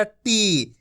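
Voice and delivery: टी stretched long, falling at the end. Shown as a demonstration. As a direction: draw out टी and let it fall. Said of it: "खींच खींच के पढ़ना है".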